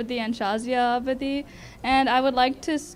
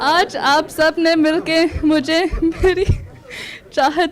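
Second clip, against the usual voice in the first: laughing; 3 semitones higher, 5 dB louder, much faster than usual. Real, not acted. Laughs, loud and fast in Hindi.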